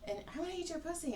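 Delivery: a lightly voiced overeager admirer; mock flirt. Imitating the chick's flirting.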